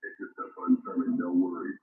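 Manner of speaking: ordinary talk, nothing marked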